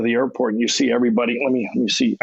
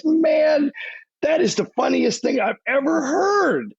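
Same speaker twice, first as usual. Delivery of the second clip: much higher, mock-amused [name] voice; [name] laughing at him; 13 semitones higher than usual. Guest imitating [name] scoffing at the [name] mix-up, much higher. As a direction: squeak it out like he can't believe the mistake. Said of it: "and he goes".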